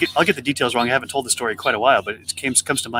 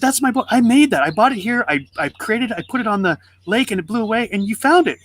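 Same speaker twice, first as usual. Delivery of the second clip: put-on high-pitched kid pleading his case; retold protest, voice pitched way up; insistent protest; 8 semitones higher than usual. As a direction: act out a boy arguing urgently. Boy argues with the shopkeeper.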